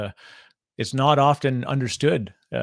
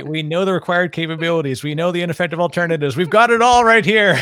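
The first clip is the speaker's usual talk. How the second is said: higher, louder, building to a near shout; hammed-up pitch rising to a big finish; mock eager boasting; 5 semitones higher, 7 dB louder than usual. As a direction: pile it on with playful, mounting excitement. Joking along as an eager lead.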